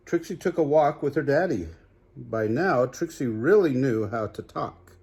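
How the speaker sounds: ordinary delivery, nothing marked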